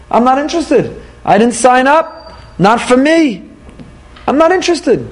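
put-on high, dismissive young rebel's refusal; mock petulant youngster saying no; defiant young person. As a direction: pitch it up and shrug off the obligation. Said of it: "A lot of younger generation wanna come along and say".

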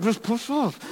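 very soft, high mock strain; strained self-urging. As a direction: squeeze it out under your breath. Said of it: "that whole thing, like".

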